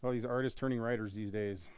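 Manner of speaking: ordinary talk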